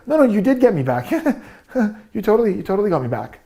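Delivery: mock a flustered laugh through the words; nervous laugh. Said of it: "she's going like".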